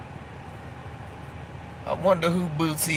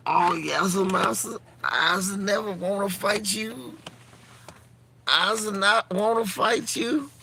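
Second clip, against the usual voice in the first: high, rising pleading mock servant; hammed-up high servile voice; 6 semitones higher than usual. Mocking servile character voice.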